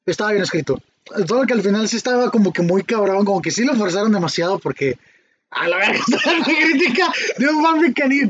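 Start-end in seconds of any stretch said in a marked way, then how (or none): 5.5-8.3 talking through laughter, pitch climbing; higher voice broken up by laughing. Real, not acted. Teller recalls his fanfic critique to friends, laughing.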